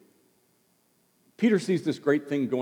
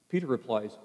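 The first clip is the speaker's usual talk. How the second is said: ordinary delivery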